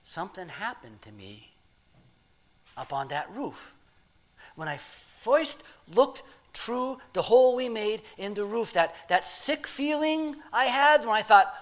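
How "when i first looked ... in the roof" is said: normal talking voice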